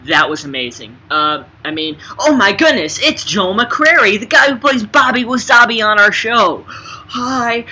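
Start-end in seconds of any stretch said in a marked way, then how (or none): 2.2-7.7 high, loud gushing cry; over-the-top fangirl squeal of delight; star-struck fan shout. Acted. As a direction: play a fan meeting a star.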